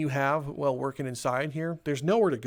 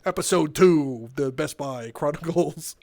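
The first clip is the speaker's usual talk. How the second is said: mock show title; 2 semitones lower, slower than usual. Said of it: "I'll be like".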